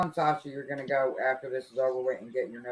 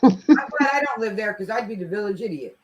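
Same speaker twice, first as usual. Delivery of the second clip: laughing; 5 semitones higher, 9 dB louder than usual. Real, not acted. Laughing self-mockery.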